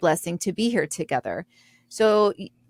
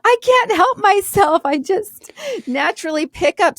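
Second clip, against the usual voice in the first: mock Southern belle voice, pitched up; Southern accent; 11 semitones higher, 4 dB louder than usual. Friend on the phone with the concierge, in a put-on Southern accent. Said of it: "she starts speaking with a Southern accent".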